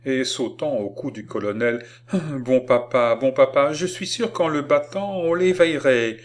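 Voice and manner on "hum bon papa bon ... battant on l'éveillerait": put-on high, urgent little-girl plea; pleading child. A narrator's reading. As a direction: beg grandpa, rising higher as you go. Child pleading with her grandfather. (supplication aiguë de petite fille)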